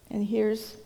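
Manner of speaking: plain reading voice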